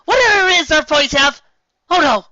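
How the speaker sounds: shrill, loud rising panic; sudden high panic at own words; panicked realization. Acted. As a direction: blurt it in sudden alarm. Realizes she has no answer.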